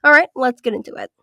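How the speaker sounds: ordinary talk, nothing marked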